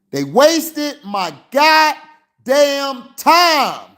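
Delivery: exasperated fan venting, high and slow; angry slow outburst. Real, not acted. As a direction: let the anger boil over, drawing every word out. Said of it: "I had to get mad".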